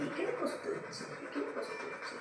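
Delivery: soft whispered cooing, a laugh in it. Real, not acted. Owner whispers to the pet where it hurts, soft whisper. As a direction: whisper gently to the tired pet.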